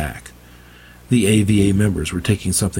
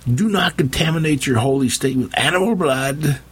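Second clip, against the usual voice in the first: much higher, desperate outcry; shouting; 9 semitones higher than usual. Acted. Preacher forbidding his flock, high outraged shout. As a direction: cry out in outrage to stop them. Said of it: "Bobson shouted".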